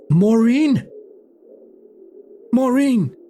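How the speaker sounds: high yelled call for someone; hollered name, high and drawn out. Acted. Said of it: "And now I yell".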